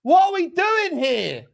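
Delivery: furious slow shout, pitched high; seething disbelief yelled at the screen; angry outburst. Real, not acted. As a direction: let the rage out at the referee, loud and drawn out. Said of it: "I'm angry".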